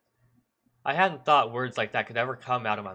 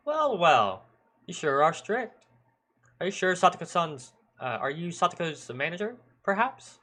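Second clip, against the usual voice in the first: higher, teasing mock-polite voice; jovial needling policeman; 6 semitones higher, 3 dB louder than usual. Old policeman hides irritation from the boy, jovial needling. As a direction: needle him with false joviality.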